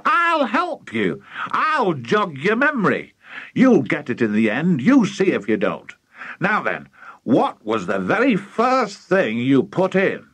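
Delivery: high start, coaxing enthusiasm; excited father prodding a memory; eager cry. Acted. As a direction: egg him on eagerly. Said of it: "cried Mister Cranky".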